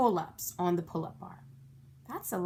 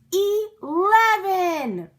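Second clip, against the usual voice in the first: high, loud, very slow cry; thrilled cheer; ecstatic shout; 10 semitones higher, 8 dB louder, much slower than usual. A narrator's reading. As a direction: stretch it out in triumph. Climactic count.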